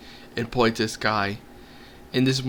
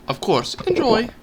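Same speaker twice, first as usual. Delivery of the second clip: cheerful innkeeper; 12 semitones higher, 4 dB louder than usual. Acted. Innkeeper Gobbar voiced.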